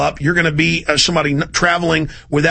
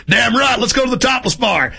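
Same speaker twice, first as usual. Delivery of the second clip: higher, swaggering soldier cheer; mock rowdy soldier; 6 semitones higher than usual. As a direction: brag like a thug off to the bar.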